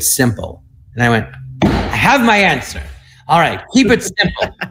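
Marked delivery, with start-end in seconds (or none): 1.6-2.8 higher, drawn-out mock revelation; triumphant realization, played up; eureka moment. Acting out a sudden revelation. 3.3-4.6 higher, played-up relieved resolve; comic revelation, pitched up; eureka moment. Seizing on the overheard advice.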